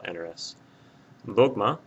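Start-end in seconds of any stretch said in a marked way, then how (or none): 1.3-1.8 Dogma hit louder on DOG; stress on the O. Shown as a demonstration. Teacher explaining why no mark is written, Dogma stressed on DOG. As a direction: punch the first syllable of Dogma. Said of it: "stress is right here over the O".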